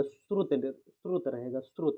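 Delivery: श्रुत, short final vowel, drawn out slowly and softly; slow careful श्रुत with no final i. Shown as a demonstration. Teacher spells out श्रुत slowly for students. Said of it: "तो श्रुति नहीं रहेगा".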